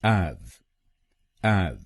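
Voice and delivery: एव said alone as the first syllable ev; teacher isolates एव, slow and separate; syllable drill. Shown as a demonstration. Teacher drills students on the first syllable, ev. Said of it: "पहले शब्दांश को ... हम बोलेंगे".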